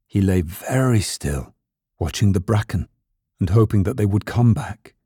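usual speaking voice

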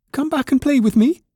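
very high, pleading rabbit voice; little rabbit calling. A narrator's reading. Toy rabbit calling fleeing rabbits, high plea. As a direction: call after them in a small, high voice. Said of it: "called the little rabbit".

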